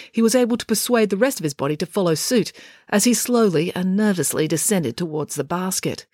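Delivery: ordinary delivery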